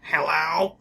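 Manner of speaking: ordinary talk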